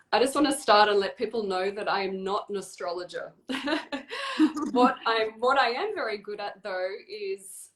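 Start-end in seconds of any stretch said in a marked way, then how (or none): 3.4-4.7 short, high, falling laugh; amused laugh. Real, not acted. Guest admitting she is no astrologer, amused laugh. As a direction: laugh it off lightly, higher pitched.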